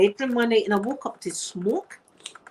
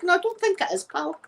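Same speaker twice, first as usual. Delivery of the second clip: imitating mum; 10 semitones higher than usual. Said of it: "mum refusing the Ned".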